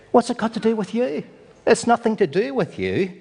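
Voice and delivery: high-pitched challenge; mock-offended comeback, pitched well up; indignant retort. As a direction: push back at someone meddling. Voices Adrian's indignant reply to forgiver.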